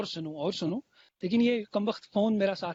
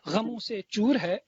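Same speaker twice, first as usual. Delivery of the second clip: stately nazm recitation; 4 dB louder than usual. Acted. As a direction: lean into each line, then pause. Recites a fresh ghazal, repeated lines.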